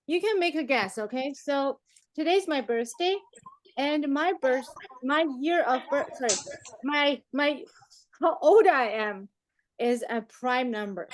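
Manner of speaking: ordinary delivery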